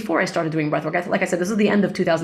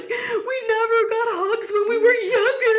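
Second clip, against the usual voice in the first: much higher, crying and laughing at once; laughing through tears. Retold or imitated. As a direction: let the words break between a laugh and a sob. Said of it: "And we're both crying".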